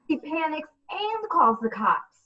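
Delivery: ordinary delivery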